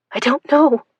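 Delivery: high, frightened answer; scared reply; panicked. Acted.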